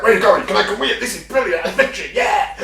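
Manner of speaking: high, loud eager dog; excited dog voice. Retold or imitated. Dog following owner to the kitchen, high loud eagerness. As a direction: bounce through it like an eager dog. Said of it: "It's like".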